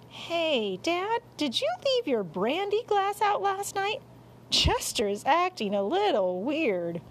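son calling to dad, high-pitched; puzzled son. Acted. Boy asking Dad about the oddly acting cat, puzzled and high. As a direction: pipe up like a kid asking Dad. Boy speaks to father in story.

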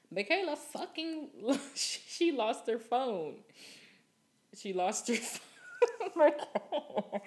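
giggling as she tells how her friend lost her phone; words breaking into laughter; laughing through it. Real, not acted. Telling listeners about her friend's lost phone, laughing.

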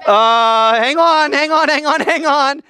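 high, panicky repeated plea; alarmed stalling. Acted. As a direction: fend off someone charging at you. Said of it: "And you're like".

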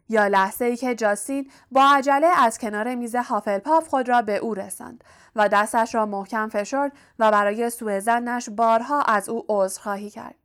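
ordinary delivery, nothing marked

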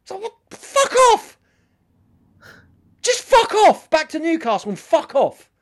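loud, high fuck off; angry outburst. Real, not acted. Ranting at Geordie fans, loud and angry. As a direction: bark it at the fans.

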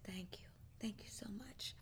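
very soft unvoiced whisper, leaning close; whispered thanks. Preacher retelling the waitress leaning down to thank her, whispering. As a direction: murmur it close to the ear. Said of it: "she leaned down and said".

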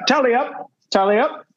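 high, heavy mock Scottish accent. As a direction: bark it like a Scottish soldier. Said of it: "in a heavy accent".

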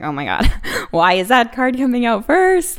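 surprised laugh at a bad omen; laughing surprise. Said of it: "So I was like".

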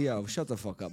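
low, soft, unangry brush-off; easygoing hushed put-down; not mad. Pretend. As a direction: say it quietly with no anger behind it. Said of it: "Like, I'm not mad".

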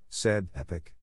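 ordinary delivery, nothing marked

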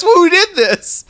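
alarmed. Acted. Acted alarmed figure.

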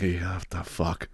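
muttered disbelief. Retold or imitated. Seeing five hundred people in line, slow, soft and deflated. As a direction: mutter it under your breath. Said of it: "I'm like".

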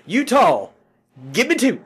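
loud, high-pitched mock holler; hammed-up shouted food order; Pappas. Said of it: "but I just love it".

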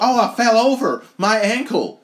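high, loud mock wail; hammed-up cry of an injured wrestler; mock pained cry. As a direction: yelp it out high and loud, playing hurt. Mock-voicing fallen wrestler.

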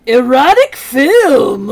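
loud, slow, drawn-out announcement; excited exclaim. Retold or imitated. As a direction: blurt it out like a big reveal. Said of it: "you're just like".

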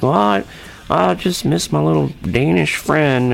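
hammed-up wistful impression; Dave's wistful voice. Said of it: "he has that other voice when he's talking about Lars".

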